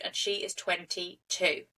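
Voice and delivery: ordinary talk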